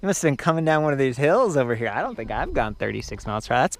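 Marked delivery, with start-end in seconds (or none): 0.0-1.9 excited. Real, not acted. Excited at the 36 mph top speed.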